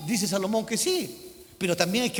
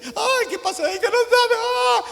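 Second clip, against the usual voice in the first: high, loud, hammed-up wailing; mock sobbing visitor; 14 semitones higher, 6 dB louder than usual. Preacher imitating a friend who cries and won't talk. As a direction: overplay a weeping friend at your door.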